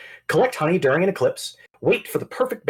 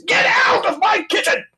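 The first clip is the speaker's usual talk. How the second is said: screaming chef; 4 dB louder than usual. Acted. Chef NPC screaming at intruder.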